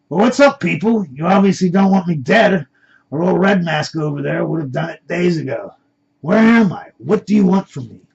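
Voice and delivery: bold, projected demand for answers; speaking up over others. Acted. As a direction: call out over a room of talking men. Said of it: "Finally, John spoke up over all the men".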